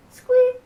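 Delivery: high squeaky squeak, then a clonk; playful squeak-clonk sound effect; squeaky bike noise. A narrator's reading. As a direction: voice the wonky pedals, squeak high.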